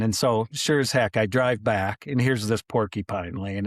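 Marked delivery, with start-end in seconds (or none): none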